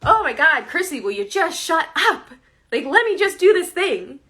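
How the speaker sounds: loud, high, fed-up yell at yourself; exasperated outburst. Retold or imitated. Yelling at her own inner critic, exasperated and loud. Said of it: "It doesn't feel good to be like".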